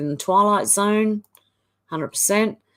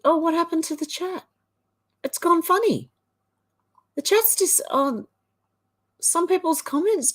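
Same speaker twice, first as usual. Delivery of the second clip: surprised; 8 semitones higher than usual. Real, not acted. Chat suddenly glitches.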